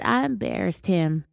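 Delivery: mock-dramatic creak in the voice; dramatic vocal fry. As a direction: imitate her creaky, dramatic reveal. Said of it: "cue the dramatic vocal fry".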